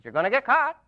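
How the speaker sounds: high squawking parrot warning. Acted. Parrot repeating its warning to the burglar, high and birdlike.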